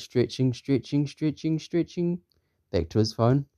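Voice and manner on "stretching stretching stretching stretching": building upward, getting thinner in volume; rising stretch. A narrator's reading. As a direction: pitch climbing with each repeat. Narrator mimics stretching with rising voice.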